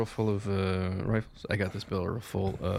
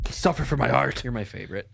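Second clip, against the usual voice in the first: usual speaking voice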